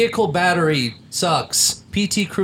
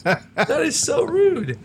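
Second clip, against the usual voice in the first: high, slow laughing outrage; laughing indignant; 12 semitones higher, slower than usual. Real, not acted.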